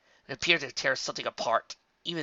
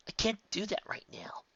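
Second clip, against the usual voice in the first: whisper; 10 semitones higher, 6 dB quieter than usual. A narrator's reading. Twilight refuses quietly.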